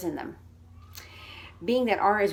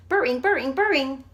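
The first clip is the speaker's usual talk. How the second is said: "Burring" rattled off quickly, higher and louder; fast and loud; 5 semitones higher, 8 dB louder than usual. Shown as a demonstration. Teacher beating the one-second timer, fast. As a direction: race the timer. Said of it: "start talking as soon as you push".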